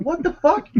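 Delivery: high, startled disbelief; shocked outburst. Retold or imitated. Gamer watching an eagle snatch his monkey, shocked. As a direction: blurt it out in shock. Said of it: "I'm like".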